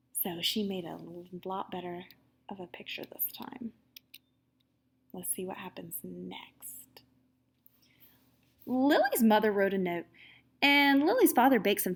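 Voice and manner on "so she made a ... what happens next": whisper, teasing what comes next. Real, not acted. Reader whispering to kids before turning the page. Quiet aside to children.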